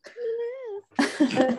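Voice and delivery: very slow high squeak; slow mouse squeak. Pretend. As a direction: drag out a tiny mouse squeak.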